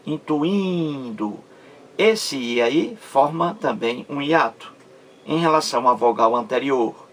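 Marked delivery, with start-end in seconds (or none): 0.1-1.4 slow "Intuindo" with a long second I; stress on second I. Shown as a demonstration. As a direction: draw out the IN before DO in "Intuindo". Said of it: "no segundo I". ("Intuindo" devagar, alongando o segundo I)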